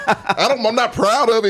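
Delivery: laughing. Real, not acted. Laughing admission about liking character.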